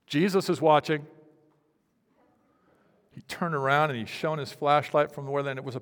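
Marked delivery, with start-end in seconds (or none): 0.1-1.1 JESUS called out loud and squawky as the parrot. Retold or imitated. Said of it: "he heard a voice from the corner".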